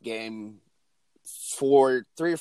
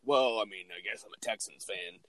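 resigned mumble; 5 semitones lower, 9 dB quieter than usual. Retold or imitated. Mock resigned Cowboys fan.

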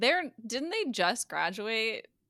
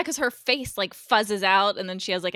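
ordinary talk, nothing marked

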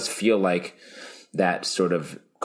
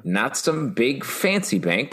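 ordinary talk, nothing marked

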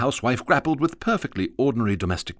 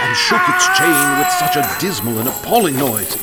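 ghostly wail; 7 dB louder than usual. Acted. Narrated spirit's frightful cry.

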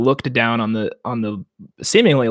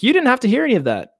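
exasperated outburst; 12 semitones higher, much faster than usual. Real, not acted. Outburst about the dead brother.

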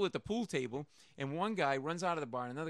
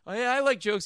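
put-on drunk guy; 9 semitones higher, 10 dB louder than usual. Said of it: "He goes".

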